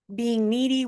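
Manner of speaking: ordinary talk, nothing marked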